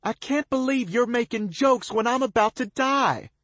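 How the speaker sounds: very high, loud, terrified; panicking girl screaming at him. A narrator's reading. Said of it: "she screams".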